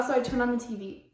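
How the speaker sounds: normal talking voice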